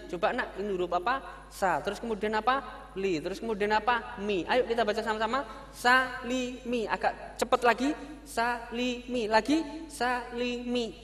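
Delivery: lightly voiced teacher to pupil; teacher drilling pupil. Teacher drills child salimi.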